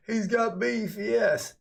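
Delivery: high, loud mock cheer; mock gloating excitement. Streamer mimicking viewers hungry for beef, mock excited cheer.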